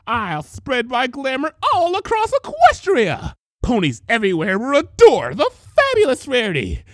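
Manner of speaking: gloating villain crow. Acted. False Rarity gloating as she rises from the bunnies. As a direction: crow it triumphantly.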